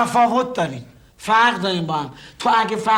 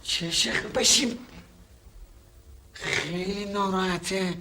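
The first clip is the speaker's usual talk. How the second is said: whisper; 4 semitones lower, 9 dB quieter, slower than usual. Acted. Thieves hiding, watching crying man.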